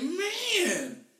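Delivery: a hammed-up thrilled reaction; excited reaction. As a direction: gush like you just heard a great song. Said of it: "I was like".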